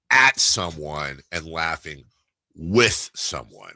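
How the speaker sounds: slow, leaning on "at" and "with"; stress on at and with. Shown as a demonstration. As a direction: spell out "at someone" against "with someone". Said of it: "there's a difference between".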